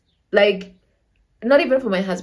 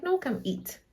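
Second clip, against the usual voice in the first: soft, calm, slightly raised pitch; 5 semitones higher, 9 dB quieter than usual. Retold or imitated. Girlfriend invites him over to eat, calm and soft. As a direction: say it softly, giving nothing away. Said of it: "normally I'm calm. So I'm like".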